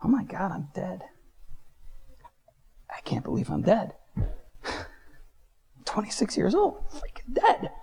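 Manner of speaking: half-whispered retold shock; scared disbelief. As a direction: let the dread sink in, partly whispered. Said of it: "And I got scared".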